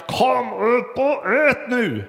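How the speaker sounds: high, slow, drawn-out call; forceful call. Retold or imitated. Mother's third call to gaming son, high slow forceful call. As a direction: stretch every word out, ordering him up now. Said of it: "ropade med kraft".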